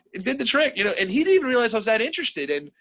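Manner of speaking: high, laughing through the words; can't hold back a laugh at his own joke. Real, not acted. Laughing at his own brutal line.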